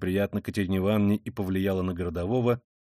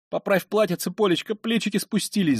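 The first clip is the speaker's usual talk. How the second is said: high voice, recovering from a cough; through coughing; 8 semitones higher than usual. A narrator's reading. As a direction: get the words out after a coughing fit. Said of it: "заметила она сквозь кашель". (сквозь кашель)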